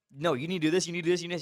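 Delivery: heated retort. Retold or imitated. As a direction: push back hard, voice up and loud. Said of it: "I got a little heated".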